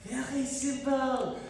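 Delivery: hammed-up French chef accent; fake French accent. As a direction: lay the French accent on thick, like a cartoon chef. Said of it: "Why are you speaking in a German accent?".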